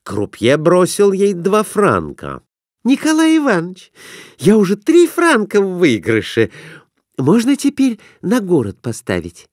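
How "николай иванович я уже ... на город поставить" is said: put-on high, girlish voice; eager wife asking to place a bet; young wife's voice. A narrator's reading. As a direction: voice the wife much higher. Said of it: "обратилась она".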